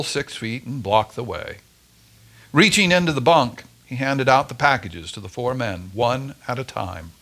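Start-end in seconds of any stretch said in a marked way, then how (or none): none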